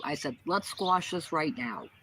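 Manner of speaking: ordinary talk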